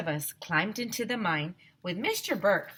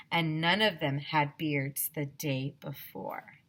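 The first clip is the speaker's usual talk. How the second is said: usual speaking voice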